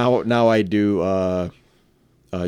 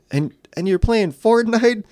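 put-on kid voice; 12 semitones higher, slower than usual. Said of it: "the kid goes".

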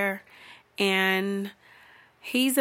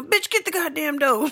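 higher, mock-exasperated order; fed-up boyfriend; 7 semitones higher than usual. Boyfriend months later at a door, mock exasperation. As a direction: bark it as a comic impatient partner.

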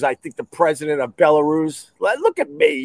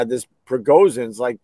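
normal talking voice

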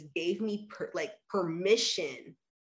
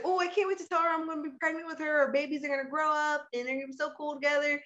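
high, quick, giddy retelling; lightly voiced excited past self; excited gush; 8 semitones higher, much faster than usual. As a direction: gush about the shared pregnancy, fast and bright. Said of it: "And so I was like".

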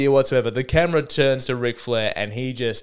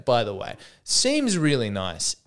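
ordinary talk, nothing marked

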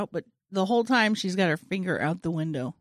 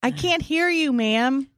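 raised, louder teller voice; bank teller; 6 semitones higher, 8 dB louder, slower than usual. Acted. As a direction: call out to a customer you can't hear. Acted bank teller, cannot hear customer.

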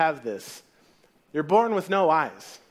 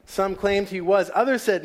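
ordinary talk, nothing marked

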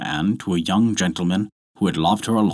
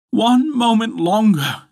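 high, drawn-out plea; pleading wife; 13 semitones higher, 4 dB louder than usual. A narrator's reading. Wife stalling her husband, pleading. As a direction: stretch the plea out, pitched up. Wife begs for more delay.

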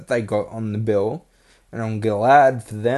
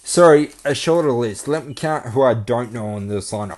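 ordinary talk, nothing marked

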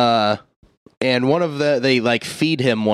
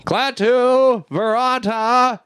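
high, drawn-out magic words, very slow; slow grand incantation; 11 semitones higher, much slower than usual. Retold or imitated. Ash reciting the spell in the graveyard, slow and grand. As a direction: intone each word like a spell, then falter. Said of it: "tries to pull a fast one on magic words".

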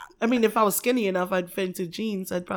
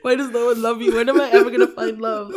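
loud, pitched-up comic despair; mock lovelorn lament; 3 semitones higher, 8 dB louder than usual. Joining friend's journal rant about love, mock lovelorn lament. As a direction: cry out in hammed-up self-pity.

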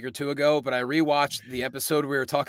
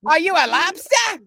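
squeaky, much higher and louder mock voice; goofy put-on voice; 13 semitones higher, 7 dB louder than usual. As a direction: ask it like a cartoon character, way up high. Mock lobster customer bit.

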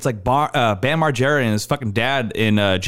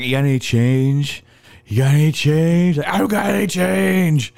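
put-on panhandler voice; 3 semitones higher, 4 dB louder than usual. Imitates exchange about spare change.

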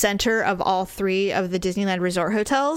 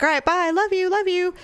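put-on higher-pitched breezy send-off to a child; cheery goodbye; 9 semitones higher than usual. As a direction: toss off an affectionate mum goodbye. Mother dropping daughter off.

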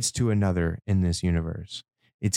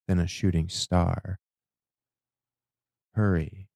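ordinary talk

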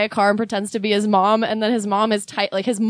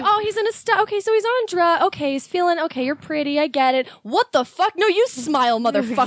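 mock indignation; 6 semitones higher than usual. Acts out sympathy snapping into outrage.

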